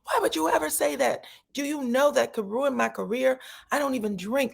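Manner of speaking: high-pitched retold outrage. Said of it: "She was crying".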